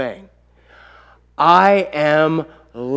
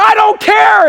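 very high, loud retort; angry shouting; 8 dB louder, slower than usual. Retold or imitated. Dad overruling son while hammering, angry shouting. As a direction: shout the child down, acting furious.

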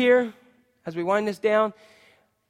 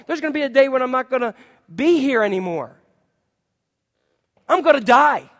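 loud, driving, higher preaching; full-force preaching; 5 semitones higher, 7 dB louder than usual. Acted. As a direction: hammer it home to the congregation.

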